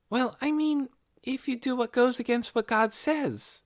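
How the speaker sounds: put-on high, light girl's voice; a curious young girl asking; young girl Nikki. A narrator's reading. Nikki's lines in the story.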